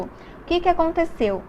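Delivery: ordinary talk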